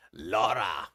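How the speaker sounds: short, low playful holler; mock shout. Host greeting Laura as she walks in, mock shout. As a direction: call the name out in a low put-on voice. Calls out to arriving viewer.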